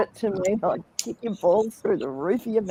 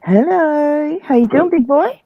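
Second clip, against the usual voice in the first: big, higher-pitched, sing-out hello; playful loud greeting; 4 semitones higher, 8 dB louder, slower than usual. Real, not acted. Answering the host's hello, playful loud greeting. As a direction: belt out a teasing hello.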